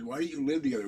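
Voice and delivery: ordinary talk, nothing marked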